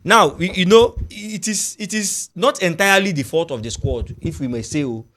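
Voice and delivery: normal talking voice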